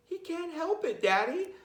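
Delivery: voiced high like small kids; high child voice. A narrator's reading. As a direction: plead as the little kids. Children plead in story.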